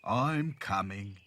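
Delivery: slow, weary doll voice; sighing. A narrator's reading. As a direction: drag it out with a sigh. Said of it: "he sighed".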